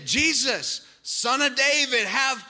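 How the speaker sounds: loud, high, desperate cry, slowing at the end. Acted. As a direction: cry out with everything. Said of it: "He shouted all the more".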